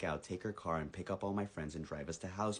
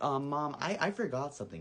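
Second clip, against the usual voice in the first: higher, halting plea to mom; nervous excuse; 7 semitones higher, 4 dB louder than usual. Retold or imitated. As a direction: stammer out an excuse, pitched up.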